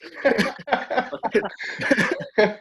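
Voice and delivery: high, drawn-out real laugh; long laugh. Real, not acted. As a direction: crack up at the memory. Laughing at own story.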